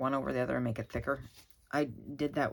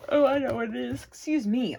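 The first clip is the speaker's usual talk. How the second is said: yawning; 9 semitones higher, 8 dB louder than usual. Real, not acted. Yawning again, apologizing.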